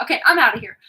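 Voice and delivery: normal talking voice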